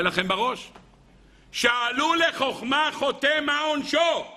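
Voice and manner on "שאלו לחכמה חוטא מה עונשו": slow, high-pitched solemn recitation; declaimed quotation. Real, not acted. Preacher reading a Midrash line to his listeners, declaimed. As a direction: draw it out high, posing the ancient question.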